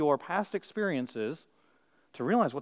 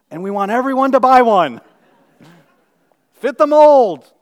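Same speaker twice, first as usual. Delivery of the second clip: loud, high ironic yell; big booming shout for comic irony; mock sales shout; 10 semitones higher, 18 dB louder than usual. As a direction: holler it like a hammered-up slogan. Mocking the ad's contradictory message.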